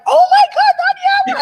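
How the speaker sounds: put-on spotting an old friend at a fair, thrilled squeal; excited squeal. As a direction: squeal her name with pure joy. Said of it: "I was just like".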